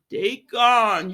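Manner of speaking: loud, drawn-out calling of the name; big exasperated imitation; mock exasperation. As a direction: cry out his name in hammed-up dismay. Acts out Sheila scolding Deacon.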